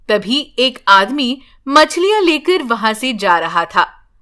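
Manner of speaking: ordinary delivery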